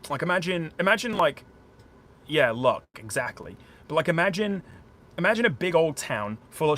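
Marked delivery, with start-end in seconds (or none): none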